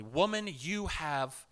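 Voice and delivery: slow, low and gentle; a slow, gentle, solemn pronouncement; solemn blessing. Retold or imitated. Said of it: "it says very specifically".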